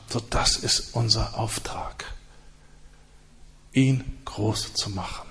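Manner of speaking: whispered, almost breathless; soft reverent whisper; hushed whisper. Real, not acted. Preacher drops to a hush.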